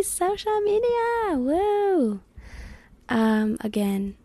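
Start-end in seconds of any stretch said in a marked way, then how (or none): 0.0-2.2 much higher, stretched cheer for "Social media! Woo!"; hyped announcement. Real, not acted. Host teasing listeners about next episode, high excited cheer. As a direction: sell next week's topic like a game-show reveal.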